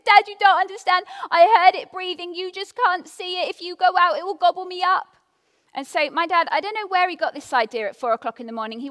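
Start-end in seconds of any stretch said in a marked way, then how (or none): 0.0-5.1 put-on high, loud pleading little girl; insistent scared child. Little girl pleading with her dad, high and scared.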